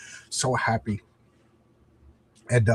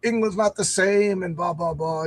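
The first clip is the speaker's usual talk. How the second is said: mock complainer; 5 semitones higher than usual. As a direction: lightly parody the whiners, slowing down. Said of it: "everything on Twitter about, like".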